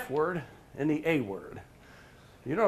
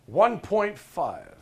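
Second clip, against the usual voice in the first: slow grudging; 3 semitones higher, 4 dB louder, much slower than usual. Retold or imitated. Worker's grudging rating.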